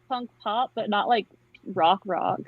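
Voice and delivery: normal talking voice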